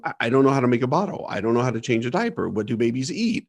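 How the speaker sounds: fast, tumbling panic; panicked rush. Retold or imitated. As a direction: rattle it off in rising panic. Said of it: "you start going into panic mode".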